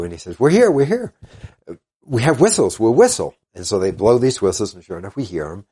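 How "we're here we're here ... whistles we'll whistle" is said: much higher and louder, urgent calling. Retold or imitated. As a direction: shout so the rescuers can find you. Lost hikers calling to rescuers.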